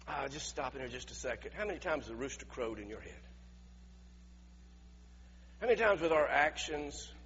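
ordinary talk, nothing marked

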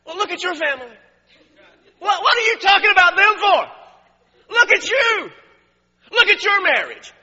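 high, full-force indignant yelling; shouted retort. Acted. Preacher acting out a retort to condemning people, shouting indignantly. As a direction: explode at the hypocrite, building to a shout.

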